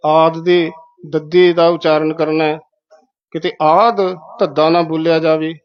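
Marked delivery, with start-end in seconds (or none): none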